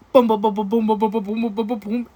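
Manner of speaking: loud then fading boom boom heartbeat; heartbeat imitation. Her head on his chest, imitating his heartbeat fading.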